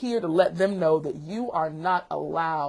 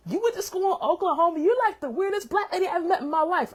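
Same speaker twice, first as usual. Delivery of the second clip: imitating a kid; 12 semitones higher than usual. Kid quoted mocking teacher.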